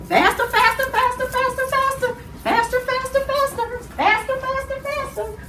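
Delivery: high, rapid-fire chant on one pitch; excited urging. Pretend. Host racing children in a pretend dinosaur run, high excited urging. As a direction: egg the runners on in a high voice.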